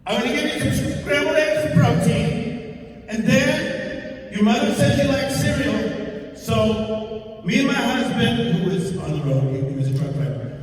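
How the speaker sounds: high sing-song mock instructions; sweet host mother. Comic imitates the host lady's high sweet reminders to wash. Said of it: "people think about this".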